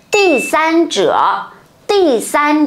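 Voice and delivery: Tỷ san said slowly, high and drawn out; Chinese pronunciation demo. Shown as a demonstration. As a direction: model the Chinese word Tỷ san clearly. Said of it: "bọn mình có thể có hai cách nói".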